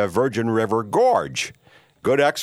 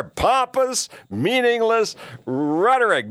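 pitched way up, sneering the insult back; mocking simpleton; 13 semitones higher, slower than usual. Imitating listeners who mock the host, high jeering voice. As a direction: jeer it like a smug heckler. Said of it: "simpletons are out there going".

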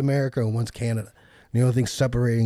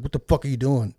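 ordinary talk

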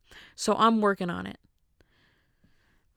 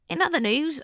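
higher-pitched mock British news accent; British newsreader accent; 7 semitones higher than usual. Host turning the show British, mock British anchor. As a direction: open the bulletin in a put-on British accent. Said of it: "I'll make it a British television show".

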